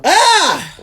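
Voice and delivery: high, loud "ah" cry. Retold or imitated. Worn-out young mother alone, crying out. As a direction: let out one sustained high yell. Acts out what followed breastfeeding.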